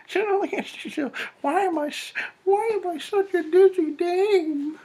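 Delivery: very high, squealing falsetto; mock dizzy dame. Reader mocking flustered Anastasia scolding herself, silly falsetto. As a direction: put on a shrieky falsetto, hammed-up self-scolding.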